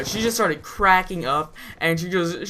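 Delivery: usual speaking voice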